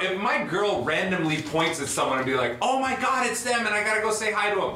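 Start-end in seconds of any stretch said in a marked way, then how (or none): 2.6-4.7 higher-pitched imitation of an excited fan; excited girlfriend. Girlfriend randomly pointing at someone she loves, excited imitation. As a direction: mimic a girlfriend spotting her favorite artist. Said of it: "how excited my fucking girl is".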